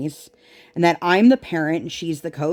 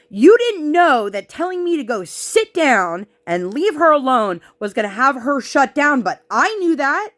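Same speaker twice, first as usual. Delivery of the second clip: heated rant; 6 semitones higher than usual. Real, not acted. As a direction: push back hard at the coach. Ranting at the coach.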